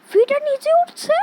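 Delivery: loud, high startled question; shocked outcry. Real, not acted. Gamer startled by the moving feeder, loud shocked cry. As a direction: blurt it out in shock.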